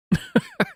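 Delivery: short high-pitched laugh; high burst of laughter. Real, not acted. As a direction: let out a sudden squeaky laugh. Laughing at the clip.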